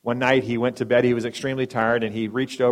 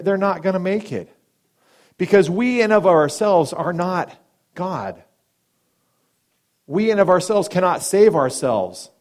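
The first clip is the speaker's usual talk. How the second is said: full-force preaching; 5 semitones higher, 6 dB louder than usual. Acted. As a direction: proclaim it to the whole church.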